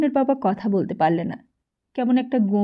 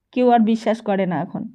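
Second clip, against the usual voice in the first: ordinary delivery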